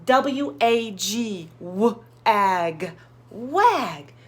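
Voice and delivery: slow letter-by-letter spelling; slow careful spelling; spelling a word. Shown as a demonstration. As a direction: spell it out slowly for a child. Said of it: "he spelled".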